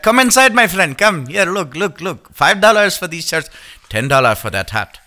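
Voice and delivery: put-on accent, coaxing sales patter; imitated foreign street vendor. Street vendor coaxing passers-by to buy, imitated accent. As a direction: hawk shirts in a mock foreign accent. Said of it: "those guys on the side of the road".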